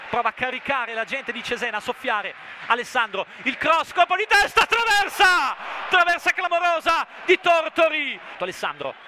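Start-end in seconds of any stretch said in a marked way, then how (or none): 3.4-8.2 loud, high crossbar cry; breathless italian goal commentary. Real, not acted. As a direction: shout the near miss. Shot hits the crossbar.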